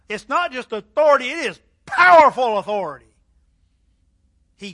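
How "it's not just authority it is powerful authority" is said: emphatic preaching. Real, not acted.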